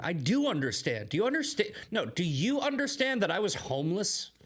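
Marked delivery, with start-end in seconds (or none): 1.1-4.3 heated challenge. Real, not acted. Heated rebuttal.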